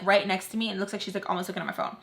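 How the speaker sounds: ordinary delivery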